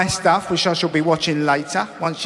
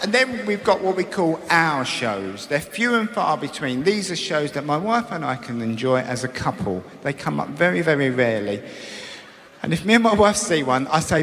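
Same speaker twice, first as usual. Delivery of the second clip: ordinary delivery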